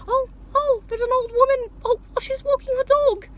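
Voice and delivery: high-pitched, fussy British onlooker voice; British accent. Pretend. Vlogger playing onlookers spotting an old woman, mock British accent. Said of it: "I said that in a British accent".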